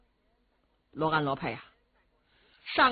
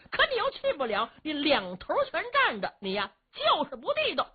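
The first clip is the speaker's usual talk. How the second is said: heated scolding; 6 semitones higher than usual. Acted. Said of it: "哥哥，您别嚷好不好啊？".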